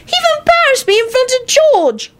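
put-on a kid grumbling about a pest; high child voice, annoyed. Teddy voice.

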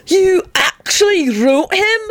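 slow, high-pitched incredulity; stretched, squeaking-high astonishment; disbelieving yell. A narrator's reading. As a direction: draw out ACTUALLY in high disbelief. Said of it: "Benji yelled in disbelief".

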